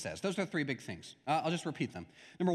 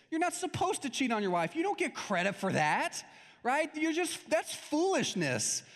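higher, exasperated comic scolding; hammed-up exasperated rant; exasperated Chris Rock; 6 semitones higher, slower than usual. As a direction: scold the fool like a comedian. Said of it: "he always wants to look at him and go".

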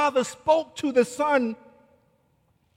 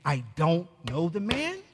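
low, soft disbelieving question, rising on man; hushed, repeating the words in disbelief; incredulous echo; 6 semitones lower, 7 dB quieter than usual. Retold or imitated. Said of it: "he is saying".

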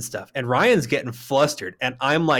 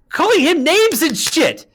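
high, loud and drawn-out indignation; outraged shout; 13 semitones higher, 4 dB louder, slower than usual. Real, not acted. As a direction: yell it slowly in sheer outrage. Repeats it in outrage.

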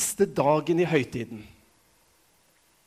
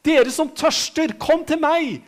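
high, loud, slow summons; Jesus crying out; 7 semitones higher, 4 dB louder, slower than usual. Retold or imitated. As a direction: cry out the invitation to the thirsty. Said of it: "som om Jesus sier".

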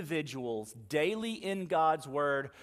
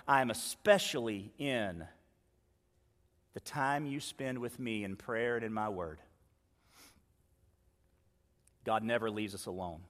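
gentle close; 6 semitones lower, 6 dB quieter than usual. Real, not acted.

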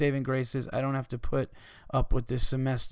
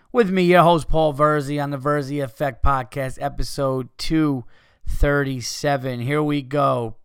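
host intro; 6 dB louder than usual. Acted. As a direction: sell the show intro like a big host. Podcast intro announcement.

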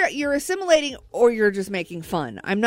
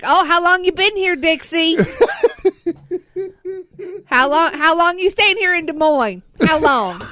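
mock Southern accent; 7 semitones higher, 4 dB louder than usual. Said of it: "asked a couple of questions in a Southern accent".

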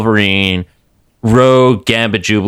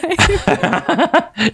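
high laugh. Real, not acted.

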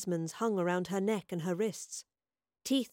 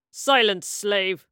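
loud military bark; barked command; 7 dB louder, much slower than usual. A narrator's reading. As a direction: bark it to silence a slave. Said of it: "Quintus barked".

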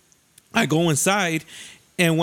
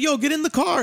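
high-pitched retold call to a friend; raised voice of friends urging; imitated calling out; 9 semitones higher than usual. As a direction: mimic friends calling him over, higher. Friends calling him to the car.

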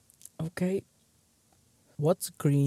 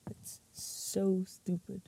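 slow whisper, trying not to laugh; whispering to herself to stop the laughter; 4 semitones higher, 11 dB quieter, slower than usual. Real, not acted. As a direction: breathe it out slowly, holding back giggles. Suppressing laughter at bad joke.

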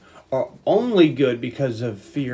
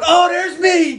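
high, loud happy surprise; gleeful recognition; surprised delight; 6 dB louder, much slower than usual. Retold or imitated.

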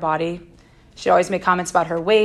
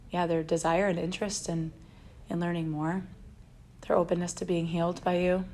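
hushed, soft, unhurried prayer voice; quiet prayer; 10 dB quieter than usual. Real, not acted. Opening the talk in prayer, softly and reverently. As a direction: pray gently and softly to God.

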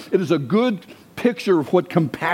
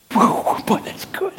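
whispered aside; 10 semitones higher than usual. Real, not acted. Preacher marvels at own point.

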